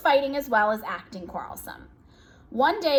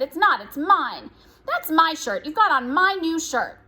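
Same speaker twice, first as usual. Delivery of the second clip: raised, higher bickering voice; quarrelsome; 5 semitones higher, 4 dB louder than usual. A narrator's reading. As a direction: argue back with emphatic possessiveness. Parents' acted quarrel over belongings.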